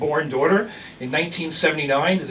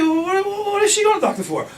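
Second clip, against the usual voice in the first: surprised; 13 semitones higher than usual. Retold or imitated. Asking about the doctor, surprised.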